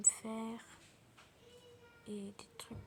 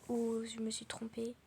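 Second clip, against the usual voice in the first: ordinary talk, nothing marked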